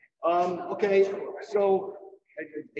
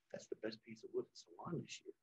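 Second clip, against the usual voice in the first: low, much softer awed compliment; hushed praise; 6 semitones lower, 14 dB quieter than usual. Retold or imitated. Pascal praises Louis's wood quietly, much softer and lower. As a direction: say it quietly, in awe.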